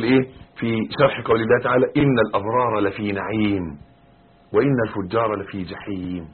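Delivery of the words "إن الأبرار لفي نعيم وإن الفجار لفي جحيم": measured, restrained Quran quotation; measured recitation. Shown as a demonstration. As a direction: recite the verse evenly, without pushing. Verse recited before his commentary.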